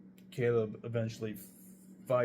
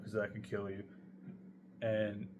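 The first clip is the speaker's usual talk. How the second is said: ordinary delivery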